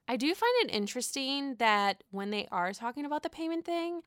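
usual speaking voice

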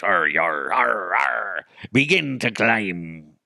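hammy pirate reading a treasure poem. Pretend. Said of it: "if I read it as a pirate".

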